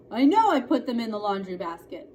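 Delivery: fretting and loud; a fretful girl insisting. A narrator's reading. Girl frets to her mother about lost shorts, loud and fretful. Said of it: "Adele was saying fretfully".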